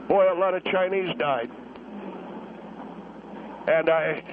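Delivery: subdued, mildly choked-up war veteran, with long pauses; getting emotional. Acted. Veteran caller recalling Korea to a radio host, getting emotional. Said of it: "I know I'm getting emotional".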